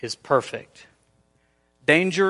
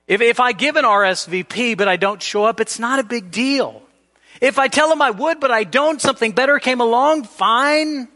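careless shrugging voice; 6 semitones higher than usual. Retold or imitated. Quoting careless promise-breakers.

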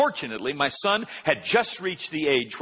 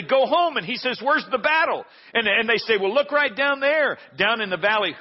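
higher, louder brothers' voices; lightly voiced brothers; 6 semitones higher, 3 dB louder than usual. David's brothers telling him off, louder and higher. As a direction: bark it like big brothers.

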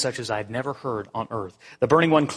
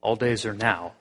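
ordinary delivery

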